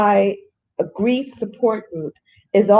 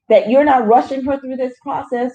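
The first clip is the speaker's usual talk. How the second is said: ordinary talk, nothing marked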